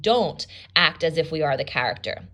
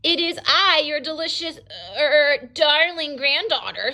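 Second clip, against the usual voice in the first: put-on a wolf faking a sweet little girl; wolf in a high voice; 8 semitones higher than usual. The wolf fools Granny at the door in a high put-on voice. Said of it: "said the wolf in a high voice".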